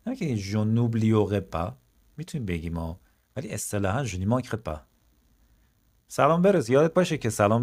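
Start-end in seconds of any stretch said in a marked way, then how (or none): none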